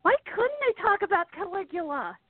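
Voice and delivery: high-pitched mock kid question; kid asking parents. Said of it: "went to their parents and go".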